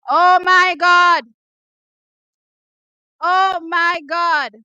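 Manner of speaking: drawn-out loud oh my God; slow astonished exclaim. Real, not acted. Fan reacting to the winning time, slow loud disbelief. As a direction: stretch it out in disbelief.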